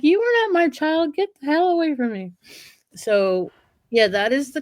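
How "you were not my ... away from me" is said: indignant cow. Retold or imitated. Other cows rejecting calf.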